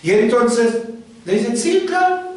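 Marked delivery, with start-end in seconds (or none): 1.6-2.3 high-pitched mocking lilt. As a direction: pitch it way up, poking fun. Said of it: "como en una especie de burla". (burlón, con tono agudo)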